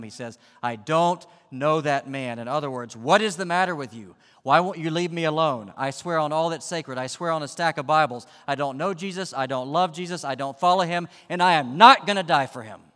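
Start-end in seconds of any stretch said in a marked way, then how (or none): 0.6-2.4 loud, slow, higher-pitched denial; swearing denial. Acted. Cornered Peter swearing at the bystanders, loud slow denial. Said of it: "He swore at them". 3.0-12.9 loud, high, cornered outburst; swearing, furious denial. Acted. Cornered Peter swearing to bystanders he doesn't know Jesus, furious shouting. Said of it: "He swore at them".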